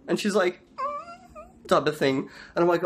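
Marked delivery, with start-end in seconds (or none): 0.8-1.7 tiny, very high, soft whine; mock whimper. Wife realising her mistake, soft mock whimper. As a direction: squeak out a faint fake sob.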